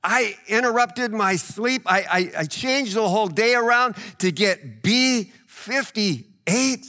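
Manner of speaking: drawn-out, put-upon complaining; exasperated disbelief. Retold or imitated. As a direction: ham up the traveller's indignation. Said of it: "And you're like".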